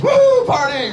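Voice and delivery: high, loud rowdy whoop; mock party-animal cheer. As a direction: holler like a carefree partying musician. Mimics pot-smoking musicians whooping it up.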